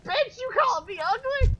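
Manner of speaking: high mock-indignant squeal; voicing a chat viewer. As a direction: play an offended diva snapping back.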